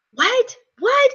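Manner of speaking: high, rising disbelief; can't believe it; stunned what. Retold or imitated. Husband opening his card, high and stunned. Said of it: "And Chuck's like".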